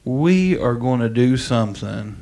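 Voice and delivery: normal talking voice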